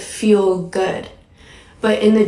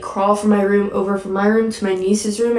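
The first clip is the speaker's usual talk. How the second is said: normal talking voice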